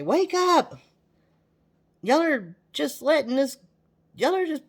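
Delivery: high, loud call to wake the country up; wake-up shout. Acted. Trump slapping complacent voters awake, shouting. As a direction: yell it like a slap in the face.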